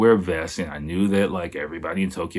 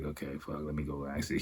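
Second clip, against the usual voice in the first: quiet inner thought; 4 semitones lower, 14 dB quieter than usual. Retold or imitated.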